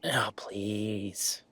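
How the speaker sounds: whispered, soft scoff; a breathy whispered eye-roll; dismissive scoff. Acted. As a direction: brush it off under your breath. Scoffing at mom's praise.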